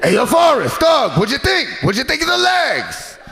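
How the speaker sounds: very high, shouted [name] question; winded [name] asking eagerly. Retold or imitated. [name] asking Forrest after the run, high shout. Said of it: "He's like".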